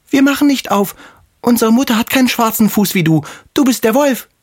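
put-on very high, accusing kid-goat voice; defiant little kids. A narrator's reading. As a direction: call out the impostor in a tiny child's voice. (trotzige Geißlein mit sehr hoher Stimme)

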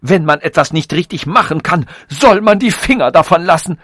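insults bursting high and loud; furious contempt. A narrator's reading. Robot condemns evolution as botched work, furious. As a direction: climb to a loud, scolding peak.

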